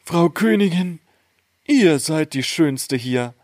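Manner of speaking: high, measured otherworldly voice; magic mirror. A narrator's reading. Mirror answers the queen, high incanting voice. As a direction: recite the verse high and deliberate. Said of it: "Da antwortete der Spiegel".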